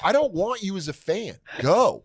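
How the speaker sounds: high, insistent mock scolding; retold angry dismissal. Retold quarrel with heckler.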